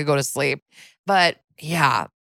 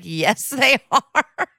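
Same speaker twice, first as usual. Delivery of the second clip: slow, higher, laughing insistence; laughing yes; 8 semitones higher, much slower than usual. Retold or imitated. Husband corrects her, slow and laughing. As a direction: laugh it out in disbelief. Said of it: "husband was like".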